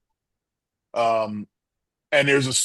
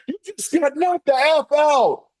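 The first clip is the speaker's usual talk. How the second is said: much higher, slow, drawn-out taunt; Chris Tucker; 13 semitones higher, 4 dB louder than usual. Retold or imitated. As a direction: stand over him and squeal it like Chris Tucker. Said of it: "I did the Chris Tucker".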